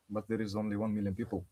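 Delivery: ordinary talk, nothing marked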